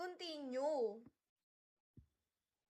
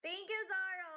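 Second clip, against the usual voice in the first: excited thanks; 7 semitones higher, 4 dB louder than usual. Real, not acted. Thanking viewer for a gift.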